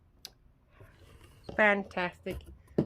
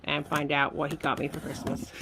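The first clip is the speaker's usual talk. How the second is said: ordinary talk, nothing marked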